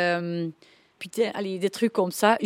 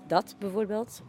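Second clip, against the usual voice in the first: usual speaking voice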